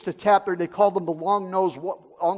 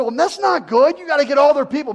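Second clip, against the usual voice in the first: urgent pilot; 6 semitones higher, 6 dB louder than usual. Retold or imitated. Pilot urges bringing people back.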